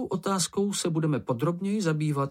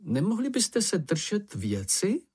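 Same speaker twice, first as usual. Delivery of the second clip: higher, drawn-out mild plea; earnest pleading; 10 semitones higher than usual. A narrator's reading. Ferko asks the others to stick to business, pleading earnestly. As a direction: beg them slowly to stay on topic. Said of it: "poprosil je snažně Ferko".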